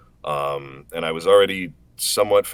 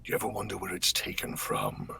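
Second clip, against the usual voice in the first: low, soft, slow whispery menace; Omen voice; 5 semitones lower, 7 dB quieter than usual. Acted. Voice actor reciting his character's line on stream, low quiet menace. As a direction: let it drift out cold and quiet. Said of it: "recognize most of the lines".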